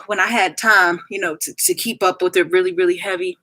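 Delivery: ordinary delivery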